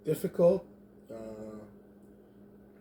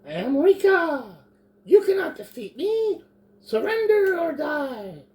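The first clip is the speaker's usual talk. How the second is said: shrill-pitched tiny goblin yelling; high goblin shout; 9 dB louder than usual. Acted. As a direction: scream it high like a goblin warrior. Player voices goblin Rika.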